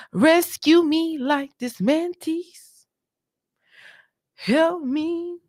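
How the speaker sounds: high, slow playful begging; mock desperate help; mock plea. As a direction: ham up a cry for help. Jokingly begs viewers for rescue.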